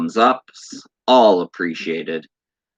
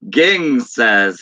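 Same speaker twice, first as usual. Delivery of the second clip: ordinary talk, nothing marked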